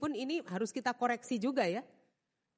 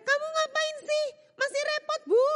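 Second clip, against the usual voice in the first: much higher, exasperated yell; shouting employer; 5 dB louder than usual. Retold or imitated. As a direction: employer yelling, maid fobbing her off. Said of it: "Anda teriak".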